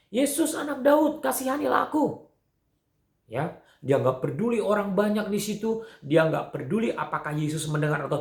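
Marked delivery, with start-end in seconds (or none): 0.1-2.3 crying out. Acted. Blind beggar calling to Jesus over the crowd, crying out. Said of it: "Lalu orang buta ini berseru".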